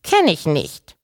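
put-on high, squeaky old-granny denial; piepsende Großmutter. A narrator's reading. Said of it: "piepste die Wawuschel-Großmutter". (piepsige Großmutterstimme)

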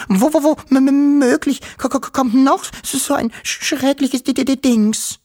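very high, loud stutter; panicked stammer. A narrator's reading. Terrified Wischel asking about another dreadful thing, panicked stammer. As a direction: trip over every consonant in high-pitched fright. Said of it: "brachte sie schließlich heraus".

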